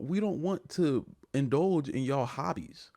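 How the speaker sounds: normal talking voice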